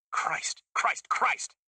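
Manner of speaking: panicked unvoiced gasps of Christ; breathless panic at the controls; frantic driver. A narrator's reading. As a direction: driver panicking as the car bucks. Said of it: "punching frantically".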